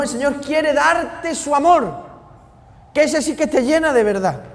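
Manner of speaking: rising passionate pulpit delivery; fervent preaching. Acted. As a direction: preach it out to the congregation with fire. Preaching at full force.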